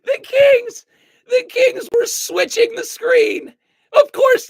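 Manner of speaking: high voice, fighting back real laughter; stifled laughter. Real, not acted. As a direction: try to explain while on the verge of cracking up. Trying to explain while cracking up.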